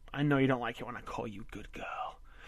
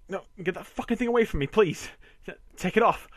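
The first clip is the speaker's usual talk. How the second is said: high, loud, rushed pleading; panicked; 6 semitones higher, 6 dB louder, faster than usual. Acted. Frightened husband begging his wife, panicked. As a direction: blurt it out in panic.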